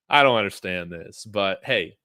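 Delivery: ordinary talk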